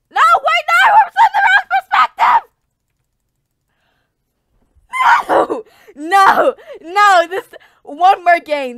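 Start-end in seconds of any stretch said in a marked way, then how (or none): 0.1-7.3 high shouts breaking into laughs; frantic anguished yelling; panicked screams. Real, not acted. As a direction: scream in despair.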